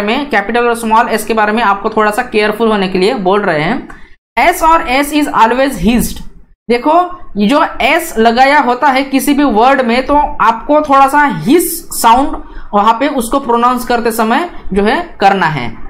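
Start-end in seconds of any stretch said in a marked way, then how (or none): none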